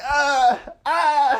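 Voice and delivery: loud, high exaggerated "ah"; mock thrusting grunts. Friend imitating the man thrusting with the dress, loud mock grunts.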